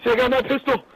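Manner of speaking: high panicked shouting; scared horror game streamer. Real, not acted. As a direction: scream in real panic. Map flooding, panic.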